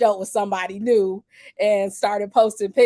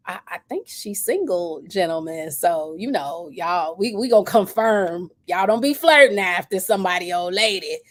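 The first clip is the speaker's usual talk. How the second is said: normal talking voice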